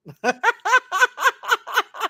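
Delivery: long, high burst of laughter; real high-pitched cackling; loud cackle. Real, not acted. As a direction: crack up loudly at your own joke. Laughing at own testosterone joke.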